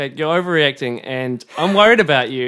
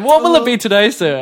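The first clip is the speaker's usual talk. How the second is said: lightly put-on, higher polite server voice; dentist's assistant; 9 semitones higher, 8 dB louder than usual. Host pretending to be a dentist offering sunglasses, mock polite. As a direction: offer the sunglasses rack like a waiter. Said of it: "they take you to a rack".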